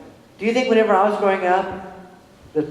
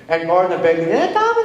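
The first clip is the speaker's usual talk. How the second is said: imitated British accent. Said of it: "he could do a British accent".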